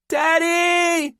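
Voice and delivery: put-on very high, long loud child's call; far-off cry. A narrator's reading. Girl overboard answering her father, calling from far off. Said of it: "her voice far off".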